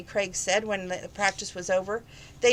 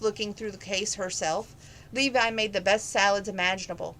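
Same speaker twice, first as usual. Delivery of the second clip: ordinary delivery, nothing marked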